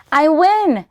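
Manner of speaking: high, loud gleeful shout; triumphant cheer. Acted. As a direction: crow over the win. Grandmother wins the dreidel game.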